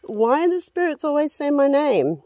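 high, loud startled outburst; spooked exclaiming. Real, not acted. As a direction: blurt it out, rattled. Spooked by spirit box saying name.

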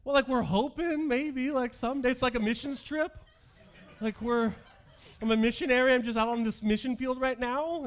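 high, loud, sarcastic sales pitch with laughs; mock-earnest spin on a hopeless date; sarcastic mission talk. Teen son to parents, about his date, high sarcastic joking.

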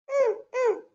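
put-on high, soft toddler hum; little girl agreeing. Child saying yes to the maid, high little voice.

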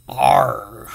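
a growled pirate "R"; teacher's pirate growl on "R". Shown as a demonstration. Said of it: "like a pirate".

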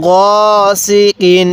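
"গসিকিন" read slowly, vowel held one count; one alif elongation. Shown as a demonstration. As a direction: spell out "গসিকিন" slowly with a short pull. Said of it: "এরকমভাবে শিখব". ("গসিকিন" এক আলিফ টানে ধীরে)

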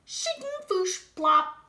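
high, loud shovel sound effects; digging noises. Acted. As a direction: voice the spoon digging with playful noises.

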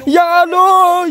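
loud, high, drawn-out cry to God; panicked wail. Real, not acted. (nyebut banter lan dawa amarga wedi)

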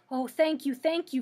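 delighted, emphatic gratitude; grateful cry. A narrator's reading. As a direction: cry out your thanks eagerly. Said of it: "cried the Scarecrow".

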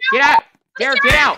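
high, loud shouted order; yelling command. Acted. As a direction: bark it at the suspect. Officer yelling at fleeing suspect.

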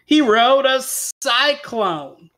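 slow, high dramatic reveal; grand tall-tale punchline. A narrator's reading. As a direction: announce the big surprise, stretching it out. Big reveal in the story.

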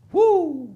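loud, high-pitched "woo"; exultant whoop. Real, not acted. Preacher rejoicing over a promise, whooping. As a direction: let out a loud, joyful whoop.

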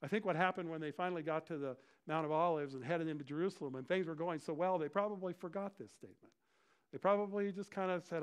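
normal talking voice

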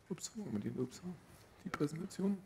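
very soft, half to oneself; absent-minded aside. Real, not acted. Speaker hunts for the presentation on stage, absent-minded quiet aside. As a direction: talk quietly to yourself while searching. Fumbling for the presentation, talking to self.

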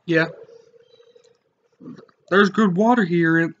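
ordinary delivery

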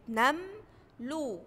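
slow "نملو", split as nam, then lu; syllable by syllable. Shown as a demonstration. Teacher starts analysing the word, "نملو" slowly split into syllables. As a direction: separate "نم" from "لو". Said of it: "أول كلمة عندي هي كلمة إيه".

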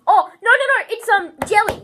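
loud, high intro voice; youtuber impression. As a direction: shout it like an excited youtuber.

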